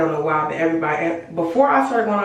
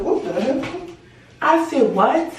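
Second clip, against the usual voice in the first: surprised; 7 semitones higher than usual. Retold or imitated. Stranger at the door.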